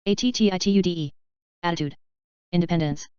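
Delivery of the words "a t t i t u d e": quick letter run; brisk recap spelling; fast spelling. Shown as a demonstration. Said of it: "spelling drill, slow then fast".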